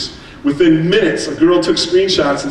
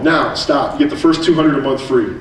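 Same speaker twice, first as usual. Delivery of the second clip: ordinary talk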